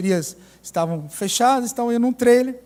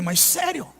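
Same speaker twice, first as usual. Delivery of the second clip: ironic; 5 semitones higher, 6 dB quieter than usual. Retold or imitated. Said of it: "Eu, às vezes, sou irônico".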